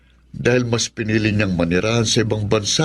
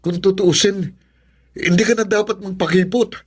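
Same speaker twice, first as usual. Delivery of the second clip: pushy insistence; 8 semitones higher than usual. Acted. Man pressing reluctant woman.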